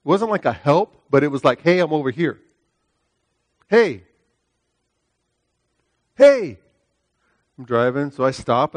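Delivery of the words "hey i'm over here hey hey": retold calling out. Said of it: "I just hear this screaming".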